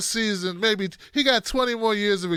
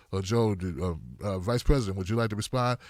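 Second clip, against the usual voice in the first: much lower, hesitant moderator; 13 semitones lower, 5 dB quieter, faster than usual. Retold or imitated. Moderator nervously inviting Joe to respond, low hesitant voice.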